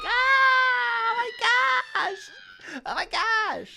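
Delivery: high-pitched squealing fangirl scream. Retold or imitated. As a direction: shriek like a starstruck fan. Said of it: "that fangirl scream".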